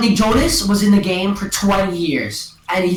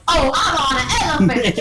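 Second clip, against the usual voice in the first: high shouted goofy movie-star voice; mock Kevin Hart; 9 semitones higher than usual. Kid retelling the movie, shouting the elephant line. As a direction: yell it like the comic hero riding in. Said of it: "he literally says that".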